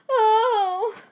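high, loud anguished "Oh"; a sharp cry as hope falls; cry of despair. A narrator's reading. Woman hearing there is no letter, sharp high cry. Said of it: "cried A Kin Sao".

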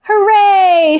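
high, long storybook hooray; mild cheering shout. A narrator's reading. Mole sees the rope tied, cheering shout. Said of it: "shouted Mole".

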